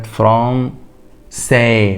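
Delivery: فرانسي very slow, the middle sound a clear s; c cedilla as s. Shown as a demonstration. Teacher showing the class how to say فرانسي. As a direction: draw out فرانسي with s, never k. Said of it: "ما ينفعش أقولها فرانكي".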